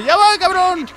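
high-pitched holler, rising at the end; re-enacted shout. Player showing viewers how he yelled back, re-enacted shout. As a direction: repeat your shout for the audience. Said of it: "Ahí le grité, ¿sí vieron?".

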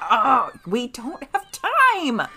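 high, slow exasperated protest; high exasperated whine. Real, not acted. Pleading protest.

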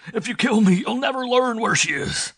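very high, loud, desperate scream; panicked, full-force plea; frantic shriek. A narrator's reading. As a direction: shriek it as if being strangled. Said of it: "His voice rose to a frantic shriek".

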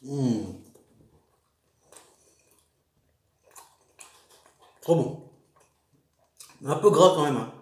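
talking between bites; chewing. Real, not acted. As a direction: mouth partly full of food. Seen 2: bit wrap at -5, jaw working, cheeks full while speaking.

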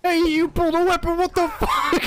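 high, loud cry of betrayal; furious protest to the game character; outraged yell. Real, not acted. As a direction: shout at the cheater, half laughing. Opponent breaks the brawl rules.

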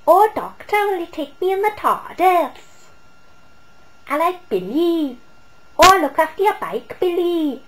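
high, loud, singsong Welsh; mock Welsh accent. Mocking the actress with doctor and Billy, overdone Welsh accent. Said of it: "trying to do an accent".

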